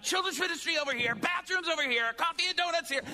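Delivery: high, loud, rushed announcements; hurried, squealing imitation of a nervous host; frantically fast. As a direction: fire them off in mock panic. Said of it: "I'm moving really fast".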